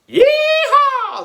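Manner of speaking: very high, drawn-out cowboy whoop; jubilant shout. A narrator's reading. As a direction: whoop it loud like gold rushers. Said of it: "they all shouted".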